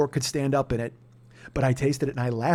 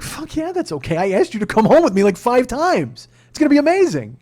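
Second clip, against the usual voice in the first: high, loud, overjoyed; husband thrilled his wife is flying home with him; 9 semitones higher, 7 dB louder than usual. Retold or imitated. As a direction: burst out with delight. Thrilled reply to wife's ticket.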